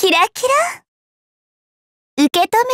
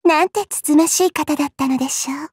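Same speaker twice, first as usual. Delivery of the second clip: normal talking voice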